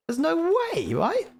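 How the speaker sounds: high squeaky doubt; disbelieving. Real, not acted. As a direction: ask in disbelief.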